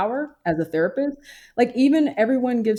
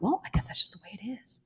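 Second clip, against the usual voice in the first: whispered, much softer, resigned; resigned inner whisper; 3 semitones higher, 9 dB quieter than usual. Retold or imitated. Recalling her resigned private thought as a soft whisper. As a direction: breathe it out like a private shrug. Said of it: "because I thought".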